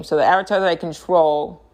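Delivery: usual speaking voice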